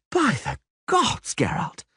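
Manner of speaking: out of breath, high and slow; panting. Acted. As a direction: gasp it out after climbing. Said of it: "the poet panted".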